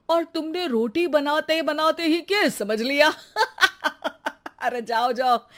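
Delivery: talking through scornful laughter; mocking laughter. Husband laughing off his wife's case advice, through laughter. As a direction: laugh her idea off as a joke.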